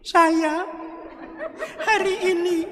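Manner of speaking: high, teary bride's voice saying her vow; tearful bride. Retold or imitated. Bride vowing to husband through tears, high and teary. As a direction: lift way up into a crying bride's tremble. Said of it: "Lalu yang istri".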